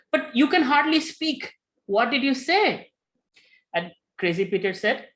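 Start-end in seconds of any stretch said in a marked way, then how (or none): 0.1-2.9 high, loud, amazed asking; astonished question. Retold or imitated. Devotees questioning Peter, astonished.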